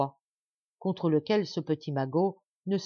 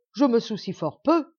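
louder, higher angry retort; angry dwarf; 5 semitones higher, 7 dB louder than usual. Acted. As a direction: spit it out in anger. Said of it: "dit le nain en colère". (le nain en colère)

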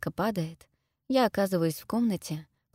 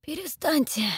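high, choked plea; strained, constricted begging; strangled voice; 10 semitones higher than usual. A narrator's reading. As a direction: plead as if your throat is squeezed. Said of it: "просит он сдавленным голосом".

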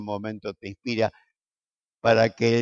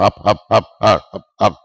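a lightly hammed-up chant of up, up, up; mock pep talk; 4 semitones lower, 4 dB louder than usual. Peter cheering up Jesus before the cross, a light mock chant. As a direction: cheer him on like a half-joking coach. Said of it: "lo agarra el mismo Pedro y dice".

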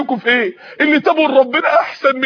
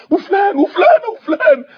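voice breaking; 7 semitones higher than usual. Acted. Lists the dead, voice breaking.

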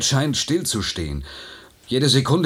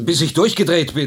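rising panic, higher and louder; agitated; 7 semitones higher, 4 dB louder than usual. Acted. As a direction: blurt it out, losing control. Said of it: "beruhigen Sie sich". (aufgebracht, höher und lauter)

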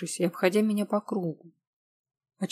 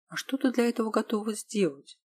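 usual speaking voice

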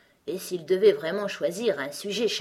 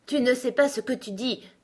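mild, raised-pitch outcry; exclaimed rebuke; 7 semitones higher, 4 dB louder than usual. A narrator's reading. Gros Coco contradicts Alice, exclaiming. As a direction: cry out in contradiction. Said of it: "s'écria le gros coco".

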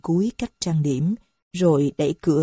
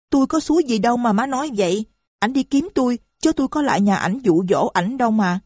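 fast, higher, snapping back in defiance; defiant retort; 6 semitones higher, faster than usual. A narrator's reading. Lan snapping back at the old mother, fast and defiant. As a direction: talk back hotly and quickly. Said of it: "Lan sừng sộ".

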